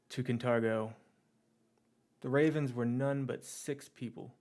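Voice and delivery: measured in-character address, long pauses between phrases; departing leader's speech. Acted. Leader offers the Ravens a place beside them, measured speech.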